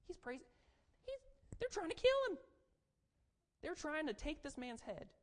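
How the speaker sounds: high, loud disbelieving cry; incredulous outburst. Acted. David's men aghast he praises while hunted, shouting.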